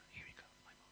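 hushed, moved whisper; tearful whisper. Acted. Father sees his son return, whispering. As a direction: breathe it out with wonder.

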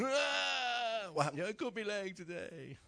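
hammed-up wailing child, high and loud; mock-sob it to get sympathy; fake crying. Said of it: "keep a cry going".

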